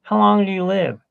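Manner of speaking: put-on little girl. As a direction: ask like a little girl, up high. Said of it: "let's say the little girl says".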